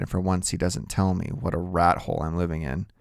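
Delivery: usual speaking voice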